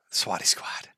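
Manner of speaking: whispered under the breath. Real, not acted. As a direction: breathe the slogan to yourself. Whispered catchphrase aside.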